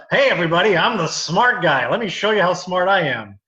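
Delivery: loud, hammed-up braggart; playing the cocky smart comic; mock smug smart guy. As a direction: announce yourself with mock pride. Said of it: "they're like".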